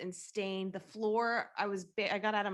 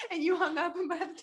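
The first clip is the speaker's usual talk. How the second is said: laughing; 10 semitones higher, 4 dB louder than usual. Real, not acted. Recalling shared fit of laughter.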